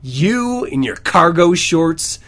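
usual speaking voice

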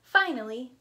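usual speaking voice

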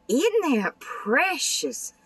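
high, slow, delighted coo; Isn't that precious drawn out high and slow; gushing delight. Real, not acted. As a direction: swoon over how precious it is. Gushing over fold card.

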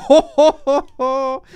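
loud, high triumphant ho ho; gloating laugh. Real, not acted. Gamer gloating at a rival knocked out, laughing loud. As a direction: crow over the fallen rival.

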